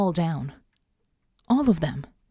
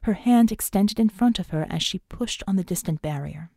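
usual speaking voice

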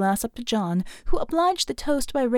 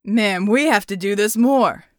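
put-on drunken exclaim; 6 dB louder than usual. A narrator's reading. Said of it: "Red exclaimed".

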